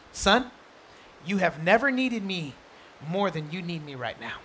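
gentle, quiet fatherly words; tender father. Retold or imitated. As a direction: speak softly to a son in trouble. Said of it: "he says this".

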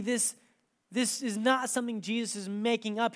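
usual speaking voice

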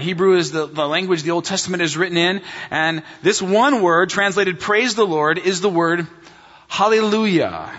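ordinary talk, nothing marked

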